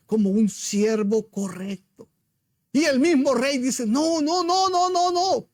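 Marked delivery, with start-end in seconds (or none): 3.9-5.5 higher pleading no, no, no; a king begging it not to be so; king's distressed refusal. Retold or imitated. King protesting his servant's sentence, raised distressed voice.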